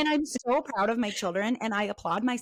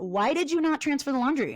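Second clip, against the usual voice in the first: higher, exasperated mom demand; instant frustration; 6 semitones higher than usual. Retold or imitated. Mom assuming kids didn't listen, frustrated. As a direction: accuse them as you walk in. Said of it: "instant frustration".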